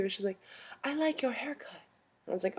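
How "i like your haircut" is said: put-on softer, higher old European lady; lightly voiced old lady. Old lady admiring a haircut, lightly imitated, high and soft. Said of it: "This little old lady comes up".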